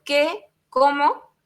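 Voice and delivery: ¡qué sorpresa!; surprised demo. Shown as a demonstration. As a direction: read it as a stunned double question. Reading example of emphatic question.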